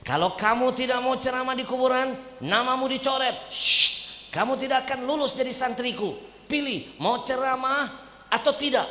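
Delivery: high, loud stern threat; stern teacher. Retold or imitated. Teacher threatening his student, stern and loud. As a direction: play the strict teacher laying down the rule.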